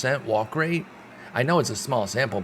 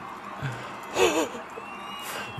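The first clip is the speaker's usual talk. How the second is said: gasp; 5 dB quieter than usual. Real, not acted. Walk puts winning run on.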